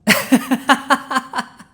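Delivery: a loud, high burst of knowing laughter; amused dragon laugh. Acted. Dragon laughing at being asked about his evil plot, loud and amused. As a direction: laugh it off like the question delights you.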